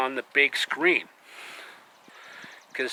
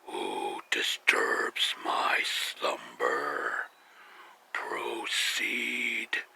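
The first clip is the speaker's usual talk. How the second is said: very deep, slow, whispered stone rumble; grinding stone statue whisper; Cave of Wonders voice; 10 semitones lower, 7 dB quieter, much slower than usual. Retold or imitated. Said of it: "the voice of the Cave of Wonders".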